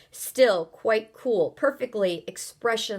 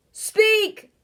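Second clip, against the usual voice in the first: loud, much higher bark of speak; booming shout; 6 dB louder than usual. Acted. Houston orders the strangers to answer, booming shout. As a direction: blast the command. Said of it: "boomed the voice".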